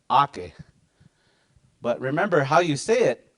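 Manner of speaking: ordinary talk, nothing marked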